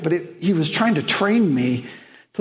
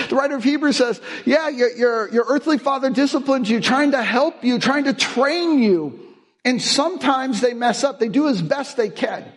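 raised, high-pitched pulpit delivery; preaching at full force; 9 semitones higher than usual. Acted. Pastor urging his congregation about fathers, with full preaching force. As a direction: press the point home to the congregation. Preacher builds on Hebrews passage.

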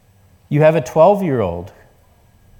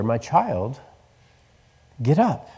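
put-on hushed, much softer call to a child; soft whisper; 5 dB quieter, slower than usual. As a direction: wake her gently, hushed. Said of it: "whispers to her".

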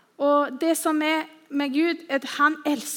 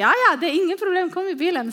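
quick, higher, louder and bright with eagerness; eager yes; 7 semitones higher, 4 dB louder, faster than usual. Retold or imitated. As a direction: rattle off a cheery offer. Cheerful driver offering a ride.

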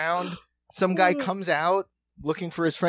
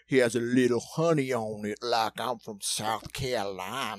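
put-on Southern accent; 5 semitones lower than usual. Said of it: "But that Southern".

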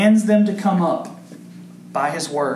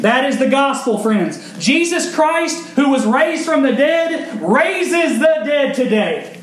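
preaching at full force; 8 semitones higher, 3 dB louder, slower than usual. Acted. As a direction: pitch lifted high, a little louder, driving the promise home. Closing proclamation.

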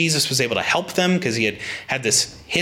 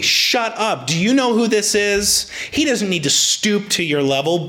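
higher scolding crowd voice; mock crowd rebuke; 7 semitones higher than usual. Crowd scolding blind men to hush, mock stern. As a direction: play the crowd scolding the beggars. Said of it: "the crowd responds similarly".

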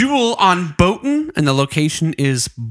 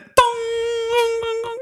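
sung dong jingle; 4 dB quieter than usual. Pretend. Imitating a phone jingle.

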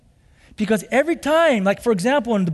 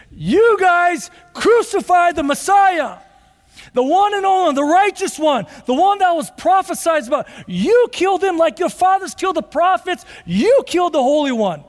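rising, preaching at full force; fiery accusation; 7 semitones higher, 4 dB louder than usual. Acted. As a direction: thunder the charge at them like a prophet. Preacher voices Stephen's accusation.

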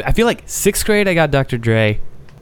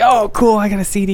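high, bright excited outburst; excited kid; 9 semitones higher than usual. Retold or imitated. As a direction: gush like a thrilled kid. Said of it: "I was like".

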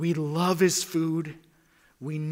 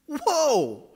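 whoa; 11 semitones higher, 4 dB louder than usual. Pretend. Awe at demons subject.

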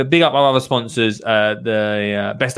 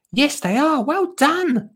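much higher, high-pitched praise; Lottie's child voice. Acted. One child praises the other, high-pitched encouraging character voice. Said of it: "said Lottie".

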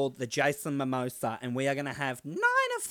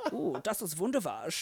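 kinky German accent; 5 semitones higher than usual. Shown as a demonstration. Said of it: "a really weird kinky sex work".

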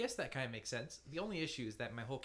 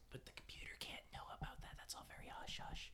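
fast, conspiratorial whisper; secret whisper; 11 dB quieter, faster than usual. Acted. Player hiding skills from the computer, whispering fast.

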